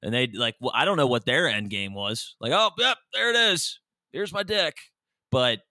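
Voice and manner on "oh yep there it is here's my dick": higher, lightly voiced casual creep; mock creepy guy. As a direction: play the guy, offhand and pleased. Imitating the fifty-year-old guys.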